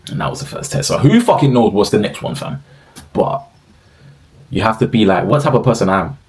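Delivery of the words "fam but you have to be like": ordinary delivery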